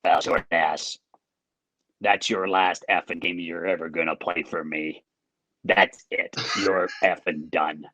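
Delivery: low gruff coach dressing him down; coach's voice. Retold or imitated. Coach telling off his young goalie, low and gruff. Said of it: "he had a great voice".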